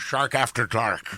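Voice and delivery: drawn-out put-on Scottish burr; Sean Connery. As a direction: announce it as a Scottish movie star. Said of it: "doing a Sean Connery impression".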